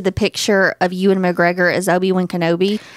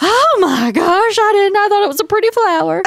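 gasp, then much higher and louder, innocent; shocked mom; 12 semitones higher, 3 dB louder than usual. Retold or imitated. Daughter imitating mom learning the flower was marijuana, gasping and high. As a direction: play the horrified innocent mom.

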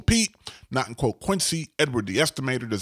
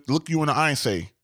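ordinary delivery

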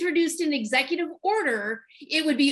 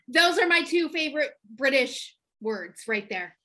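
ordinary talk

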